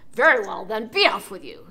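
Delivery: loud gruff dismissal; troll voice. Acted. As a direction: send him off like a troll.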